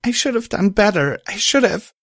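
high, distraught self-reproach; acted crying, cut short; tearful regret. A narrator's reading. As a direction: plead high as if starting to cry. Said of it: "I began to cry at this".

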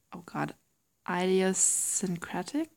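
normal talking voice

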